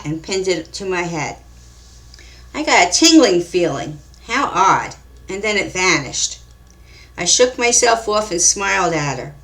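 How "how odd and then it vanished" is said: usual speaking voice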